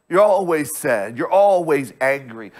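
ordinary talk, nothing marked

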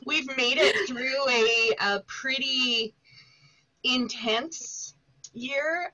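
slow, halting delivery with a pause; searching for words. Real, not acted. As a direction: draw it out, hunting for the right word. Searching for a word for the year.

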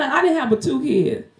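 ordinary talk

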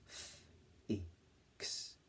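"F-I-X" whispered, each sound separate. Shown as a demonstration. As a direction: sound out "F-I-X" slowly. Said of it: "My turn".

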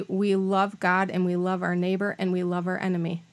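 ordinary delivery

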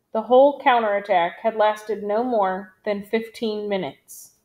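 plain reading voice

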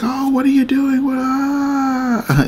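very high, wailing panic; mock freaking out. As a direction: wail like a hammed-up panicking crowd. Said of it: "They're freaking out".

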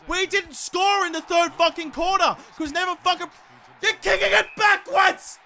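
loud, high, furious yelling. Real, not acted. Gamer screaming at his AI teammates, furious shouting. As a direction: tear into the teammates for their play.

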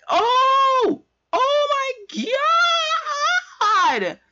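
very high, stretched shriek that cracks; voice-cracking scream. Retold or imitated. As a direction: push the high notes till the voice breaks. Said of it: "my voice started cracking".

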